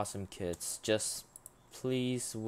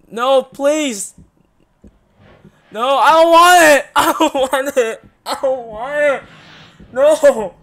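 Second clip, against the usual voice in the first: high, loud pleading screams with a nervous laugh; terrified screaming; 10 semitones higher, 16 dB louder, slower than usual. Real, not acted. Streamer facing the bloody scene, screaming and begging. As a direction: scream and beg it to stop.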